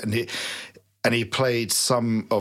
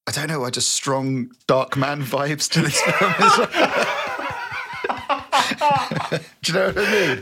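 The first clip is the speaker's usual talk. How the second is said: laughing; 7 semitones higher, 3 dB louder than usual. Real, not acted. Laughing while talking.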